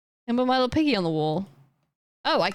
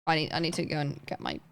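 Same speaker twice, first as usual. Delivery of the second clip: ordinary talk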